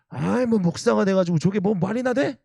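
high-pitched indignant outburst, imitating an angry elder; angry yelling. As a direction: act out an outraged church elder, voice leaping up. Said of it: "막 소리를 질렀습니다". (화난 장로를 흉내 내며 버럭)

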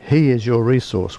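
usual speaking voice

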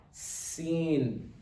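sin held long through a smile; smiling sin. Shown as a demonstration. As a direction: model SIN with smiling lips. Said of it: "just like we are smiling".

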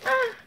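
sudden high squeal; a short startled high squeak; quick high-pitched yelp. Real, not acted. Sudden outburst.

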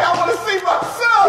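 high, fast mock yell; movie character yelling. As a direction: shout it like the desperate dad in the film. Retelling the movie scene.

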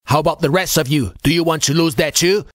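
fast, high, gruff challenge; rapid-fire dressing-down; gruff chief. A narrator's reading. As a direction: snap it out like a gruff old chief. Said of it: "a gruff voice".

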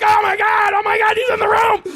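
high, loud terrified yell; panicked shout. Retold or imitated. Actor replays his panicked audition line. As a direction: scream it in hammed-up panic. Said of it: "And I'm like".

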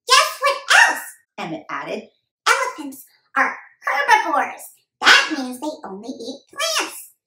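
put-on high kid voice; boy eagerly sharing animal facts; enthusiastic young boy.

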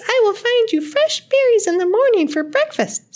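very high, tiny squeak of a voice; squeaky mouse. A narrator's reading. Lost deer mouse asks a girl for shelter, squeaking. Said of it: "squeaked the mouse".

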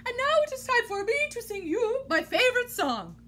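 high, loud showman build-up; grand announcement. Acted. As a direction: announce it like a big show.

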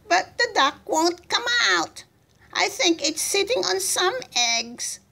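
high-pitched, fretful report; helpless excuse. A narrator's reading. Gunner explaining why the duck stays, helpless and high.

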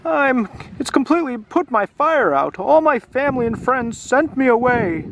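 the dragon tells how his family sent him away, mournful; sad dragon. Acted. Dragon continues his sad tale.

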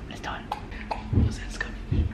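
hushed whisper. Real, not acted. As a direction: whisper it secretly. Whispering before sneaking.

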